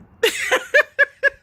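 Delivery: loud high burst of laughter; big high laugh. Real, not acted. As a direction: crack up at the punchline. Laugh after "you're weird.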